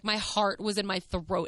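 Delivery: ordinary talk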